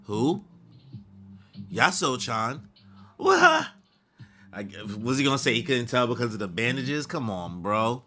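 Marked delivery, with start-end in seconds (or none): 3.2-3.7 sudden high yelp; startled. Real, not acted. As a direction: squeak it out in shock. Startled outburst.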